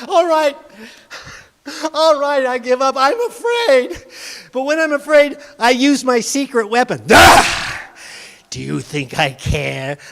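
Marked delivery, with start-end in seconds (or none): 0.1-7.6 put-on scared boy. Boy gives up, afraid.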